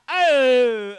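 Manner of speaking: loud crowd-pumping yell; hype shout. Real, not acted. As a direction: pump up the room.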